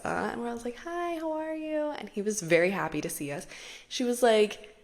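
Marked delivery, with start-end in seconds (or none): 0.8-1.9 high, slow sugary hello; sweet greeting. Retold or imitated. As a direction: greet him sweetly and slowly. Herself greeting sweetly in story.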